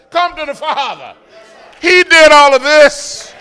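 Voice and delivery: loud, high, stretched preaching calls; fervent full-voiced preaching; preaching at full force. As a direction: whoop it up to the congregation.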